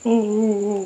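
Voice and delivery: high, wordless mock whimpering; imitated woman crying. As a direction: mimic a woman sobbing in high moans. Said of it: "Nangis gitu, habis nangis gitu".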